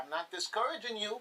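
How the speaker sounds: usual speaking voice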